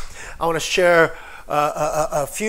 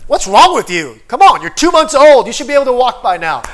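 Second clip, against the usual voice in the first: loud, hammed-up telling-off; mock scolding parent; 4 semitones higher, 10 dB louder than usual. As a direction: shout in absurd exasperation at a baby. Imagined parent scolding a baby.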